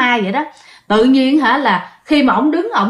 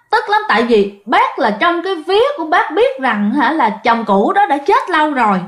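ordinary delivery

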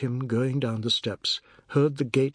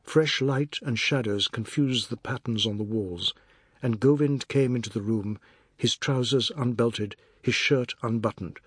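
ordinary delivery

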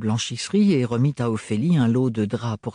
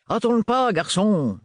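abrupt, higher call; sudden exclamation; 8 semitones higher than usual. A narrator's reading. Renard checks his watch, abrupt higher exclamation. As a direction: blurt it out suddenly. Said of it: "s'exclama-t-il brusquement".

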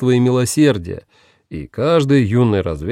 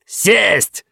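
high, sharp command; yelled order; 9 semitones higher than usual. A narrator's reading. Guerrera yells at Paxton to sit, sharp high shout. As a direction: shout the single word as an order. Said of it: "заорал Геррера".

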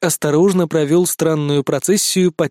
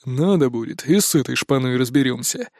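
careless scoff; 4 semitones lower than usual. A narrator's reading. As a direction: toss it off with a smirk. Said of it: "беспечно хмыкнул Серый".

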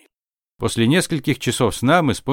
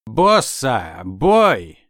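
booming warped announcer call; distorted voice; 2 semitones lower, much slower than usual. Acted. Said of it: "Искажённый голос".

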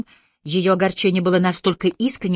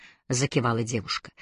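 ordinary delivery

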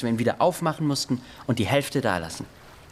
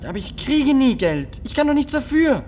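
much higher and louder, near tears; desperate cry; 14 semitones higher, 7 dB louder than usual. Acted. Boy pleading with the bully that he never gets money, desperate. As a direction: cry out helplessly.

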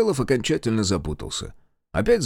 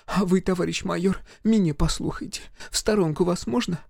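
village mother-in-law; 11 semitones higher than usual. Acted. Mother-in-law scolding her husband at the wedding. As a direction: scold the drunk husband, then coax the major.

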